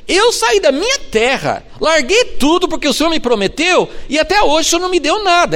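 high, loud frustrated protest; frustrated complaint. Retold or imitated. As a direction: complain that the promise never came, pitched high. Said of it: "Ele quase podia ter falado assim". (Abraão reclamando, voz alta e aguda)

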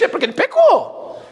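lightly hammed-up conclusion; mocking their belief. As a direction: parody their simple reasoning. Mocking the disciples' doctrine.

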